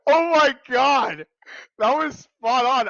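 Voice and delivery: amazed. Real, not acted. Player reacting to a spot-on guess, amazed exclamation. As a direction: exclaim it high and loud, still reeling from laughter. Still stunned after the correct guess.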